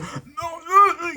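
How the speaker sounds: high, strained mock choking "no"; mimicked choking cry. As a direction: choke out a high protest, imitating him. Mimics the man choking in panic.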